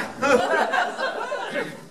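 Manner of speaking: high, long burst of laughter. Real, not acted. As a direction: laugh out at yourself. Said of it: "On va faire avec".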